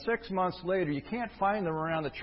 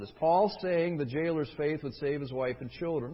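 ordinary talk

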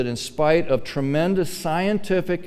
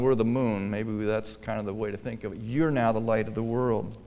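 ordinary talk

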